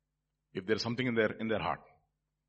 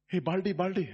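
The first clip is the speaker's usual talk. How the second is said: higher-pitched mocking jeer; mock taunt; 7 semitones higher than usual. Preacher voices the mockers jeering at Elisha, mock taunt. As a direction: call it out like a teasing heckler.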